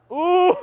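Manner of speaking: very high ooh breaking into a laugh; high ooh that falls into laughter. Real, not acted. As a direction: react with a squealing ooh, then laugh. Reacting to a wild pitch.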